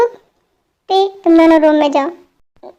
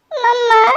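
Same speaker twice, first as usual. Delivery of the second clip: whiny, higher plea; daughter-in-law whining at teasing mother; embarrassed whine; 5 semitones higher, much slower than usual. Acted.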